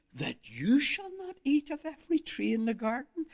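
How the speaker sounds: rising serpent question, high on "you"; stress on YOU. Retold or imitated. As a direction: devil leaning in on "you". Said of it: "the emphasis is on that word".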